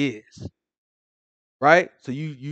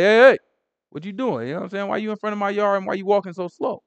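raised, higher challenging call; a little aggressive; 7 semitones higher, 7 dB louder, faster than usual. Retold or imitated. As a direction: confront a stranger outside your house, pitched up. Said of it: "I probably came at him a little aggressive".

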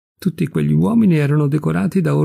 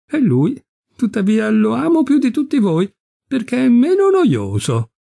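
playful teasing; 10 semitones higher than usual. A narrator's reading. Yvette teasing the suitors.

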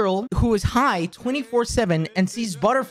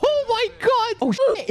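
excited; 13 semitones higher than usual. Real, not acted. Dog shown on camera.

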